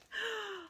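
sharp high gasp of mock surprise; surprised gasp. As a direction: gasp as if shocked by the picture. Reacting to the angry dinosaur picture.

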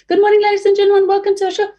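cartoonish high welcome. Shown as a demonstration. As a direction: go way up into a tiny high voice. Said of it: "and hiring".

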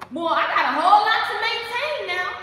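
high, loud objection to God; loud protest. Acted. Believer talking back to God, loud high protest.

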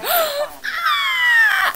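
huge high shouted gasp of delight; thrilled high gasp; excited gasp. Real, not acted. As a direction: gasp like your dream question came. Excited gasp over question.

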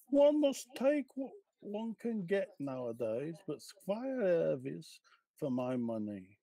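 Cockney gossip sounding wise; knowing cockney. Acted. Mrs Jones gives her opinion to the washerwomen, in a Cockney accent. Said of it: "can you please read for us in your best cockney accent".

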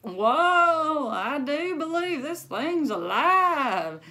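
put-on loud, high, slow old-man whoa; hammed-up amazed grandpa; startled Gramps. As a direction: marvel loudly at the wobbly bed. Gramps on sloshing water bed.

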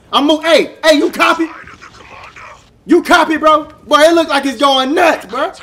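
loud excited radio check; excited calling out. Real, not acted. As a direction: call out to your squad, keyed up. Excited radio call to sergeant.

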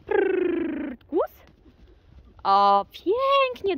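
high, loud, drawn-out praise to the horse. Real, not acted. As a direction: call out praise across the arena. Commanding horse. (głośna pochwała dla konia)

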